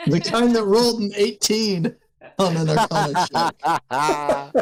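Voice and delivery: high laughing brag. Real, not acted. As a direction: laugh through a smug boast. Proud reply to warlock jab.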